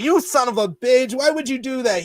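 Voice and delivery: playing Pau's fury at the medal prank; mock outraged Pau. Pau finds the medal and yells at Kobe, mock outraged.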